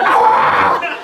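high-pitched comic scream; retold freak-out shriek. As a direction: scream in mock rage. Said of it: "Just freaking out".

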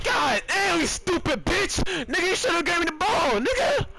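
high, raging shout at teammates; furious yelling. Real, not acted. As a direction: blow up at the useless teammate.

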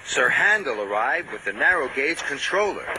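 usual speaking voice

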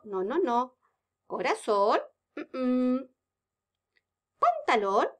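ordinary talk, nothing marked

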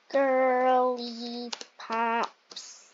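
put-on slow, stretched-out Girly Pops; drawn out. Shown as a demonstration. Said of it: "I'm gonna say".

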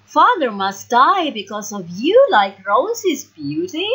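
angry sisters. A narrator's reading. Sisters blaming Beauty for their father's fate, angry. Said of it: "are very angry with".

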